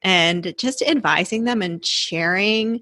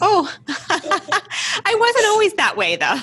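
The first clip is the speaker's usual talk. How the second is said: high, drawn-out protest through laughter; amused, slow, pitched way up; laughing protest; 10 semitones higher, slower than usual. Retold or imitated. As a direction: laugh while objecting. Laughs at being called cautious one.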